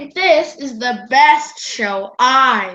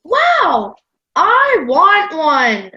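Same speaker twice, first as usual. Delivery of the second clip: put-on thrilled, drawn-out child's exclamation; excited wanting; slower than usual. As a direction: gush with eager delight, stretching each word. Child thrilled by homemade noisemaker.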